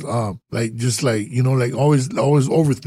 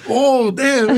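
high-pitched, drawn-out realisation; mock astonished, voice jumping up; acted surprise; 9 semitones higher than usual. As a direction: replay the moment it dawns on you. Acting out his sudden realisation.

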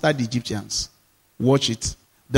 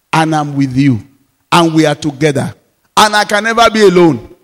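fervent pulpit prayer, growing louder; fervent preaching; 5 dB louder than usual. Acted. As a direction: swell up as you declare it.

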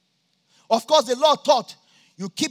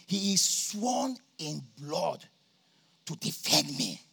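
quiet awe; 6 semitones lower, 7 dB quieter, slower than usual. Retold or imitated. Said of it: "But then they say".

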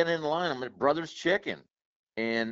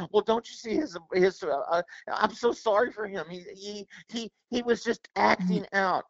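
pitched-up mock pity; mocking idiot; 6 semitones higher than usual. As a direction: sneer through a whiny mock excuse. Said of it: "some idiot's gonna go".

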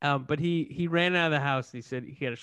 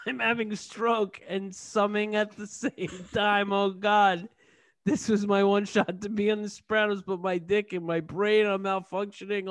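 high, strained mock agony; hammed-up dying man; 5 semitones higher than usual. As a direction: wail your last regrets in a raised voice. Acts out Johnny having a stroke.

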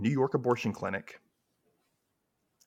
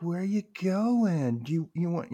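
put-on pleading girlfriend; 7 semitones higher than usual. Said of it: "She's like".

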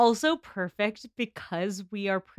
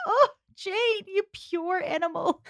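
laughing fondly; 11 semitones higher, slower than usual. Real, not acted. Fond mock address, laughing.

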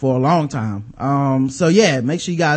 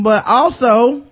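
slow, rising and louder; drawn out, higher; 6 semitones higher, 5 dB louder, much slower than usual. Acted. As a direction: stretch it out high. Repeated louder, higher, slower.

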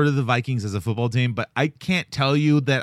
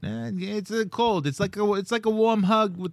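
high-pitched cartoon Canadian accent; put-on Canadian accent; 7 semitones higher than usual. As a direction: ramble like a folksy Canadian, pitched up. Continues the Canadian bit.

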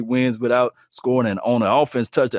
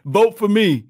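higher, louder whiny plea for votes; mocking needy player; 8 semitones higher, 4 dB louder than usual. Host mocks Deion begging fans for Pro Bowl votes, higher whiny plea. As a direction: beg like a star who needs votes. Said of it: "You think that he had to say".

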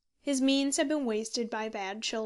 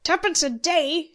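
loud, high, incredulous scorn; high-pitched scoffing outburst; scornful exclamation; 6 semitones higher, 9 dB louder than usual. A narrator's reading. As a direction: throw it back with loud contempt. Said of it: "exclaimed Spriggs with scorn".

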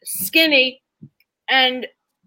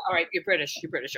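much softer, lower self-reminder; quiet self-pep talk; 7 semitones lower, 10 dB quieter, much faster than usual. Retold or imitated. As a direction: murmur it to yourself to stay in role. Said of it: "psyching herself up".

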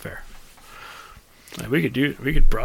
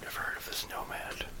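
hushed whisper into the mic; breathy whisper, no voice; 22 dB quieter than usual. Real, not acted. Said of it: "Why are you whispering at me?".

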